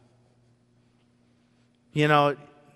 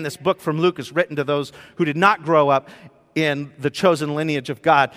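ordinary talk

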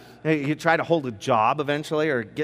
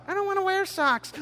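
put-on much higher little kid's protest; whiny kid. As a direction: play a small child refusing socks. Kid voiced in acted morning scene.

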